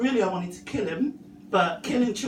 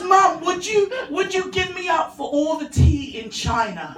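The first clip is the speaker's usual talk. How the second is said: put-on higher, louder eager toddler voice, half laughing; little boy voice; 6 semitones higher, 4 dB louder than usual. Toddler son begging his mum with a silly question, high and eager. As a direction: pipe up like a keen three-year-old.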